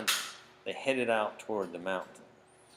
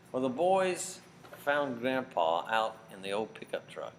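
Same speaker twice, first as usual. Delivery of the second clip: ordinary delivery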